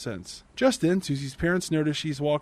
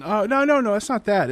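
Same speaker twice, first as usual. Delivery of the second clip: playing it off; 6 semitones higher, 6 dB louder than usual. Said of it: "he plays it off as in".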